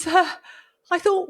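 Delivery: ordinary talk, nothing marked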